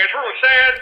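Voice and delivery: ordinary delivery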